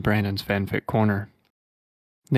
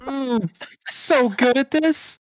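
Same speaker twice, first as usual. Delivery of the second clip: high, louder hammed-up moaning voice; moaning Sonic; 13 semitones higher, 6 dB louder, much slower than usual. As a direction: moan it out slowly, cartoonish. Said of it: "He moaned".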